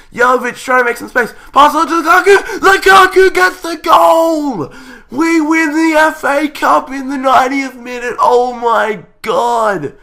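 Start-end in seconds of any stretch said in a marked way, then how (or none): 1.5-9.9 screaming, much higher and louder; winning goal scream. Real, not acted. Gamer screaming as his team wins the cup. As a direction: explode with joy at the winner.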